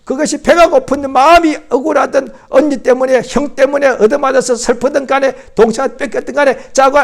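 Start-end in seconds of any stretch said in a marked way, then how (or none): none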